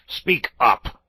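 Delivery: loud gruff bark; gruff demand. A narrator's reading. Badger calling to unseen visitors, loud gruff demand. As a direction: demand it through the door. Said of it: "gruff and suspicious voice".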